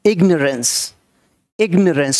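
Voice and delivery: इग्नोरेंस said as "IG-nuh-rence"; इग्नोरेंस with no "nore" in it; correct pronunciation. Shown as a demonstration. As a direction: say इग्नोरेंस with a short, weak middle syllable. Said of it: "इग्नोरेंस बोलना है".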